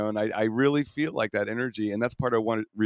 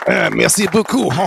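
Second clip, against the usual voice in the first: put-on French accent; 9 semitones higher, 12 dB louder than usual. Continuing the French joke.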